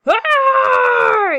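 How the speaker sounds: long, high pirate yell; loud drawn-out pirate roar; crew's yell. A narrator's reading. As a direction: holler it like a whole pirate crew. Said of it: "yells the crew".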